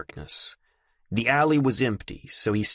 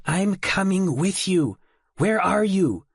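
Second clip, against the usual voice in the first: slow, firm and higher, each word set like steel; iron-willed; 10 semitones higher, 4 dB louder than usual. A narrator's reading. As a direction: refuse to be left behind. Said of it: "with iron in her voice".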